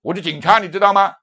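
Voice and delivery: loud, high, domineering shout; arrogant young cop. Retold or imitated. Young officer refuses the preacher, loud and arrogant. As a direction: lord it over him, loudly. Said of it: "那个二十来岁年轻人就是这样说话的".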